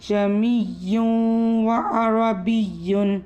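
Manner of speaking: slow Quranic recitation, second hamza softened; tashil recitation. Shown as a demonstration. Teacher recites with tashil for students. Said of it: "किस तरीके से?".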